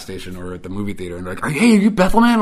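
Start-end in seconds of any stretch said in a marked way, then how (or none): 1.4-2.4 high, loud, eager fan asking; a stranger spotting a local star; excited fan. Retold or imitated. Fan in a store calling out to Mike, excited and high. Fan recognizes him in public.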